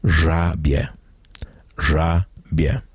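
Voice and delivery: żabie in two syllables, ża-bie, with the bie as one glide; żabie said slowly as two clean syllables, no extra vowel; correct Polish pronunciation. Shown as a demonstration. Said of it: "the correct pronunciation".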